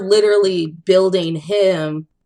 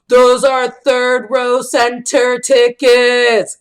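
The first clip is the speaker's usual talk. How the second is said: louder, slower, drawn out big reveal; slow hammed-up announcement; 6 semitones higher, 6 dB louder, slower than usual. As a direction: hand over the tickets like a grand prize. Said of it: "He's like".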